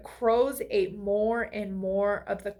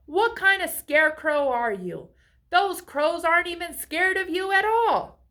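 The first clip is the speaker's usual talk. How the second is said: higher, louder scolding; scolding farmer; 7 semitones higher, 5 dB louder than usual. A narrator's reading. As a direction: scold the useless scarecrow. Angry farmer scolds scarecrow.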